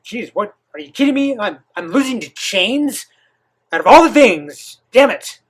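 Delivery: hammed-up outraged beast; mock frustrated Kurama. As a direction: play the fox sulking at losing to chains. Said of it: "He doesn't really say that".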